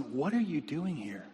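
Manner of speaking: soft, baffled question; God questioning quietly. Acted. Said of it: "I see God coming to Elijah".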